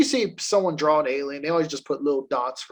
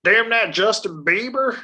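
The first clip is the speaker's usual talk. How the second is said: put-on grumpy old man; 5 semitones higher, 4 dB louder than usual. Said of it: "old man yelling at a cloud".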